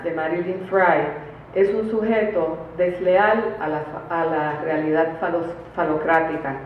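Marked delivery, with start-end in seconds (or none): none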